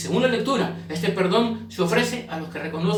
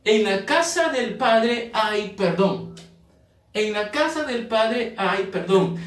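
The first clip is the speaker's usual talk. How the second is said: each word laid out slowly, CASA stressed; drawn out like a sermon headline; slow emphatic point; much slower than usual. Shown as a demonstration. Preacher announces his second point, slow and emphatic. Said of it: "Punto número dos".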